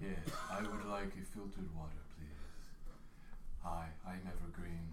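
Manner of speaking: low plant voice. Acted. Plant character speaks low.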